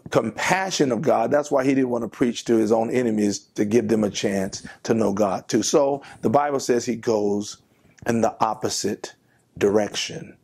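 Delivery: ordinary talk, nothing marked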